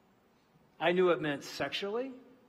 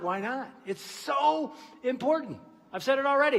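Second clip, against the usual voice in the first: ordinary talk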